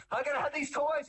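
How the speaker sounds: put-on a child begging for toys at Target; whiny child. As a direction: whine for the toys like a spoiled child. Child demanding in store.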